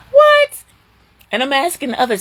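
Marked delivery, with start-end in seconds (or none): 0.1-0.6 very high, loud shocked what. Retold or imitated. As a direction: shriek of mock disbelief. Her disbelief.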